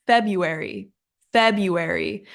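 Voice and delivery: FEBRUARY without the first R, "FEB-yoo-air-ee"; mispronounced FEBRUARY. Shown as a demonstration. Teacher admits her own habit, FEBRUARY as FEB-yoo-airy. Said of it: "but I say it like".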